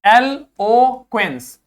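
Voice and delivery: एलोक्वेंस said slowly and firmly; slow model pronunciation. Shown as a demonstration. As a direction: spell out एलोक्वेंस with the voice. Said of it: "इसी प्रकार". (धीमा उच्चारण)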